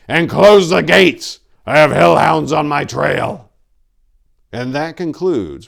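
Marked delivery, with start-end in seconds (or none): 0.1-3.5 loud, high shout over a storm; shouting over wind. A narrator's reading. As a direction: call out over the howling wind. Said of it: "Tor cried over the howling wind".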